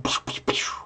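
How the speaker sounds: whispered toy laser pews; pew sound effect. Retold or imitated. Gamers firing lasers, whispered pew pew. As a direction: mimic a tiny blaster, under the breath. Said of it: "people going like".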